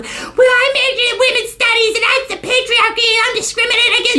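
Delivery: very high, whiny mock voice of a women's studies major; mock whiny student. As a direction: parody her as a shrill complainer.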